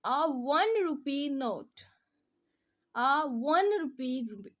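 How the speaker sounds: "one" stretched slowly from a clear w glide; w-sound demo. Shown as a demonstration. Teacher showing the consonant sound, slow "one" with a marked w. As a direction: lean hard on the w opening "one". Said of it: "यहाँ व की sound दे रहा है".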